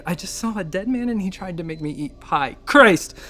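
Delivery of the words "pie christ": exasperated outburst. Acted. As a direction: burst out in exasperation. Outburst ending the rant.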